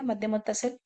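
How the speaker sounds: ordinary delivery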